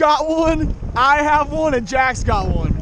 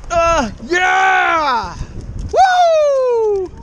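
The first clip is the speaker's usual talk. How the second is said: triumphant shout; 5 semitones higher, much slower than usual. Real, not acted. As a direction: celebrate the catch with a yell. Landing the first fish.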